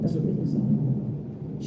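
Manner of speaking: whispered slowly, almost breathless; a slow, secretive whisper. Shown as a demonstration. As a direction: drop to a slow hush for the repeat. Repeating the card reading aloud.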